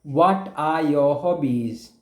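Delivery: ordinary talk, nothing marked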